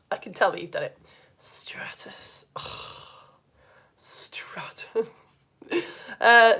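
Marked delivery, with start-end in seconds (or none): 1.4-5.1 dull hushed whisper; boring, blanketed. Shown as a demonstration. Teacher demonstrating a boring blanket cloud, bored whisper. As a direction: whisper it like a flat blanket layer. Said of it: "really boring, like, blanket layered one".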